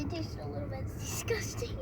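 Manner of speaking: whispering. Real, not acted. Kid tasting gross medicine.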